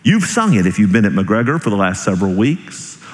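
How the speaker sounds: ordinary delivery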